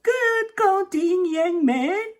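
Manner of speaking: put-on high, cheerful old woman's praise; old lady. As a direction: praise the boy like a sweet old granny. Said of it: "waddled over and said".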